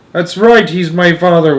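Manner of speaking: high, loud, distressed wailing. A narrator's reading. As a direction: wail it in distress. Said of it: "wailed Missus Bucket".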